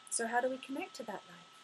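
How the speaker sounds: ordinary delivery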